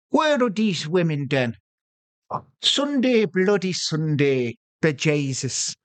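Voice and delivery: muttered-to-self monologue in mock Irish; faked Irish accent. Attacker waiting alone, internal monologue in fake Irish accent. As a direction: keep up a put-on Irish accent. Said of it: "he's got to keep the accent up even to himself".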